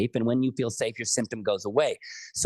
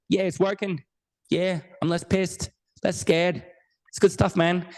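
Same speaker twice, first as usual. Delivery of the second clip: higher-pitched mock Australian accent, in short phrases; bad Australian accent; 5 semitones higher than usual. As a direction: play the rig worker with a badly faked Australian accent. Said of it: "this is my really bad Australian accent".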